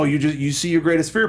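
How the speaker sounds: usual speaking voice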